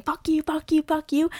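mock yelling. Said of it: "saw me yelling and pointing and saying".

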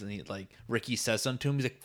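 usual speaking voice

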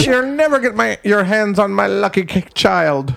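high Irish leprechaun-style caricature; hammed-up stage Irish; mock Irish accent. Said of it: "it's the luck of the Irish".

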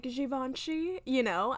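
Givenchy said with soft zh, slowly; mock the fancy pronunciation of Givenchy; Givenchy drawn out the posh way; Italian way. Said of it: "where you would be like".

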